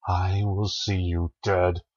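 plain reading voice

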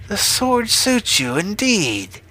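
high, slow, gloating witch voice; crowing witch. A narrator's reading. Witch triumphant over the farmer with his sword, crowing witch voice. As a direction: crow it out high and drawn-out. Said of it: "crowed the witch".

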